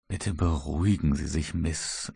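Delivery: calm her tenderly, in a hushed tone; much gentler voice. A narrator's reading. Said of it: "mit bei weitem sanfterer Stimme". (sanft und leise beruhigend)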